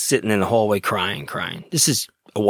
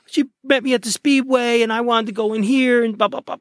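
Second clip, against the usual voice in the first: high-pitched mock whine; 12 semitones higher than usual. Officer imitating the John explaining himself, high mock whine. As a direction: imitate an upset man, very high voice. Retelling the John's tearful explanation.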